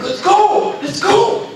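high, wild "let's go" chant; crazed hype yell. Retold or imitated. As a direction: pump up the room like a madman. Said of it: "he's going crazy".

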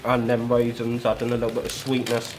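talking while chewing; muffled chewy speech; mouth full of nuts. Real, not acted. Seen 1: Tossed mix into mouth at -5; cheeks full, lips pursed while talking.

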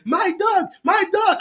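high, eager host voice; lightly voiced generous host; imitating the restaurant guy. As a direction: pitch up and push the food on him.

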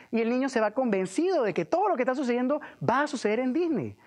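normal talking voice

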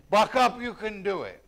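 loud, high pep-talk shout of "Buck up"; mock rallying cry. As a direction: cheer them on like a coach. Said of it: "This is not just a rallying cry".

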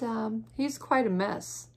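plain reading voice

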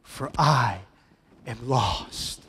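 restrained cry of awe, in separate phrases; preacher's solemn quoted lament. Acted. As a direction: cry out in measured, dramatic pieces. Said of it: "cries out".

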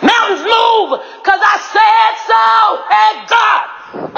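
high, drawn-out cry of command; commanding yell. Shown as a demonstration. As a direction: order mountains to move at a slow yell. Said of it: "doesn't work with me just whispering it".

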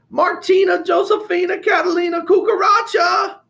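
very high, loud, stern full-name call; full-name shout. Acted. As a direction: yell her whole name like a grandmother. Grandmother calls her sternly.

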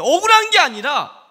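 forceful declaration. Acted. Preacher insisting to his congregation, loud. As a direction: hit it hard from the pulpit.

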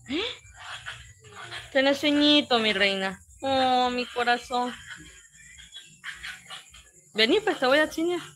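high, tender cooing to a sleepy little one. Real, not acted.

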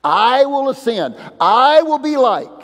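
high, loud, boastful declaration; proud Lucifer boast. Retold or imitated. Preacher voicing Lucifer's boast, loud and proud. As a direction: proclaim it like a proud rebel angel. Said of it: "Lucifer tried to do".

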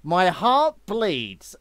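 slow, loud sarcastic pity; hammed-up sarcastic commiseration; mock sympathy. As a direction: drag it out with fake heartbreak. Mocks their rough week, smallest violin.

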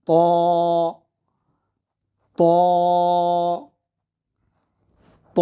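ত as a round, held "taw"; rounded ত, one-alif stretch. Shown as a demonstration. Teacher drilling students, ত rounded and lengthened. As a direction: lips tall and round, hold ত briefly. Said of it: "গোল করে উচ্চারণ হবে".

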